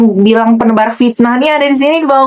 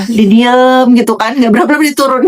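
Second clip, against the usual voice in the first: usual speaking voice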